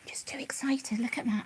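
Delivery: soft unvoiced whisper; quiet whisper beside the rabbit. Real, not acted. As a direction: hush it to the pet. Whispering near pet.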